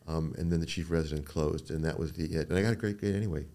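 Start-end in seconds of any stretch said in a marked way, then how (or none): none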